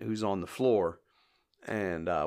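ordinary delivery

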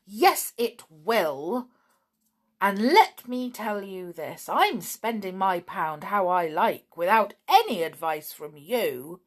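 indignant squabbling; defiant retort. Acted. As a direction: argue back hotly, stressing each point. Snap retorts in a quarrel.

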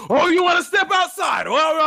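mock high, loud shouting; irate old man. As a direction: bellow it like an outraged old man. Irate old man retold, acted.

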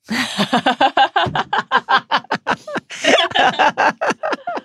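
rising burst of high laughter; big laugh. Real, not acted. Laughs at Marietta joke.

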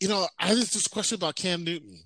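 lightly voiced mom, breezy; imitating mom. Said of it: "she goes".

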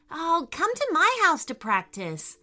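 excited. Acted. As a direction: invite them over eagerly. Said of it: "Lucy said excitedly".

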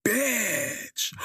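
high, loud gossipy shriek; scandalized. Retold or imitated. Hearing the Lamborghini story, scandalized shriek. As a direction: squeal it like juicy gossip. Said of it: "And I was like".

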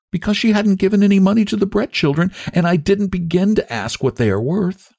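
put-on high-pitched, earnest child voice; sweet little girl. A narrator's reading. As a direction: voice a small girl, high and concerned.

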